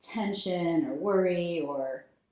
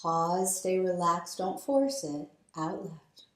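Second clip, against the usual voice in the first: guided breathing meditation. Acted. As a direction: lead them gently through each breath. Leads alternate nostril breathing.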